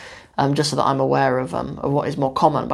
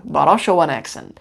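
usual speaking voice

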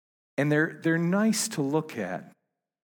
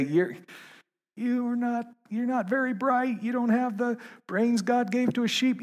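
pitched way up in a mild mock yell; 12 semitones higher than usual. Pastor imitating people yelling back, pitched-up mock yell. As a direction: lightly play an angry crowd. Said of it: "Yell and scream and say".

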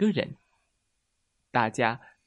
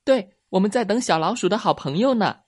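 high, loud eager little rabbit; jumping in eagerly; 7 semitones higher, 6 dB louder than usual. A narrator's reading. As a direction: rush to answer before anyone else. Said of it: "小兔子抢着回答". (小兔子高声抢着回答)

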